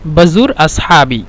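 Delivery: বাজুর আসহাবি said slowly and clearly; slow repeat. Shown as a demonstration. Teacher modelling the phrase for viewers, slowly. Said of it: "এখানে বলতে পারেন".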